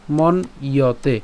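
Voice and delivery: মন ইয়তে slow with a break after মন; slow split মন ইয়তে; split syllables. Shown as a demonstration. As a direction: say মন, pause, ইয়তে. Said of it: "ম দন্তান্ন হস চিহ্ন পর্যন্ত উচ্চারণ করছি".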